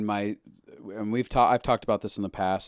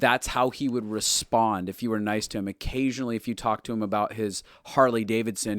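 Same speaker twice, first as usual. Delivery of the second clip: ordinary talk, nothing marked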